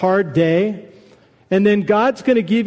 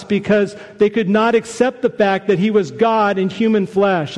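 ordinary delivery

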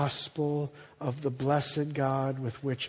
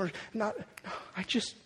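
hushed aside; 4 semitones higher, 10 dB quieter than usual. Real, not acted. Sudden drop to a whisper.

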